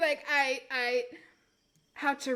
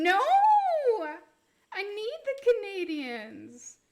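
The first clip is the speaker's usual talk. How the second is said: mock wail; 7 semitones higher, 5 dB louder than usual. Streamer begging to keep Canadians, mock wail.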